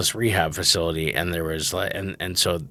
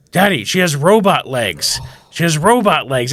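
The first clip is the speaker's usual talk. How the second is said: put-on loud, higher-pitched kid's exclamation; amazed child; 5 semitones higher, 5 dB louder than usual. Daughter to dad about prosthetic legs, loudly amazed. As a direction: announce it like an astonished kid.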